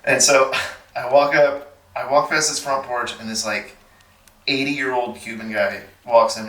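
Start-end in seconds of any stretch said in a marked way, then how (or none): none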